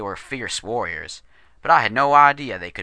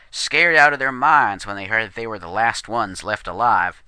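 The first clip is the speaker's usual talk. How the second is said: plain reading voice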